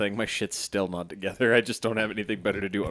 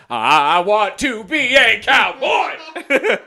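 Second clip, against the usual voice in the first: high, loud, slow laughing shout; cowboy yell; 14 semitones higher, 12 dB louder, slower than usual. Retold or imitated. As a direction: belt it like a proud cowboy. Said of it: "say it like a cowboy".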